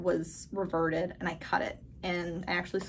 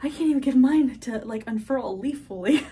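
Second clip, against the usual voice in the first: exasperated; 4 semitones higher, 9 dB louder than usual. Retold or imitated. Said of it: "And I'm like".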